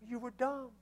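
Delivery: high, scolding inner voice; self-scolding. Retold or imitated. Preacher scolding himself over the ruined part, high and shrill-free nagging. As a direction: berate yourself like a nagging parent.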